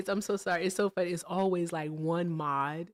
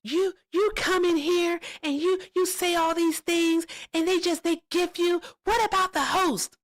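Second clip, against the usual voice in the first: much higher, louder mock-angry lady voice; imitating angry lady; 11 semitones higher, 4 dB louder than usual. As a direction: ham up the whiny complaint. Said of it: "She was like".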